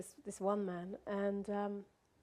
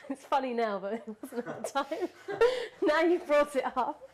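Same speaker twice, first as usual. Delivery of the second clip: laughing through the words, louder; real laughter; 6 semitones higher, 8 dB louder than usual. Real, not acted. Storyteller laughing about a knifepoint robbery now, amused and laughing. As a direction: talk through a rising, high laugh. Laughs recalling being held up.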